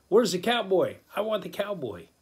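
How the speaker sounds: calling out the champ across the room; cocky challenge. Retold or imitated. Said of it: "First thing he says is".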